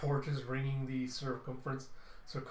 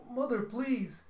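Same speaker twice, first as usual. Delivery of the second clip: desperate plea; 10 semitones higher, 5 dB louder, slower than usual. Acted. Son begging while levitated.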